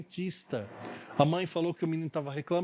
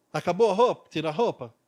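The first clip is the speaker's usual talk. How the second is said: louder mock-challenging question; challenging dentist; 7 dB louder than usual. Dentist daring the naked boy, louder, mock-challenging. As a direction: call his bluff loudly.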